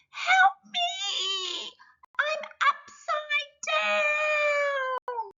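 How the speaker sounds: very high squeaky cry, slow and stretched; the ladybird, stuck upside down, cries for help in a very high squeak; tiny ladybird crying for help. Acted. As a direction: wail like a small bug flipped on its back.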